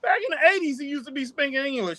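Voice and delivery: very high squeaky imitation; squeaky falsetto teasing; high mock voice. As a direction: pitch way up, playful mockery. Mocking Nikita voice, high pitched.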